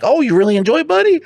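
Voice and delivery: very high, sing-song parent talk; talking to child. Retold or imitated. Father feeding his young son, high gushing baby talk. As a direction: coo it way up high to a little one, hammed up. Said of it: "Like that".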